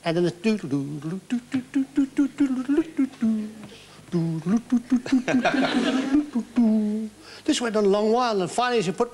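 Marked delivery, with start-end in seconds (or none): 0.1-7.1 sung doodle-doo hold tune; jokey imitation of a phone line's waiting tune; mock hold music. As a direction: hum out the hold music in nonsense syllables.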